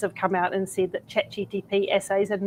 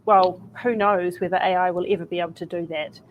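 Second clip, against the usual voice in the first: ordinary talk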